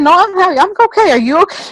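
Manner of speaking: flustered husband. Retold or imitated. Husband's flustered denial, retold.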